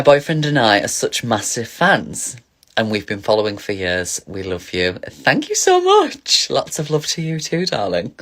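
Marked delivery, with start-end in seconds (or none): 5.1-8.1 high, slow, gushing thank-you. Real, not acted. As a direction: stretch it out, high and sweet. Gushing thanks to adoring fans.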